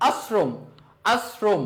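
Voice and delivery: আশ্রম with a plain s, "as-srom"; pronunciation demo. Shown as a demonstration. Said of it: "এটার উচ্চারণ করি".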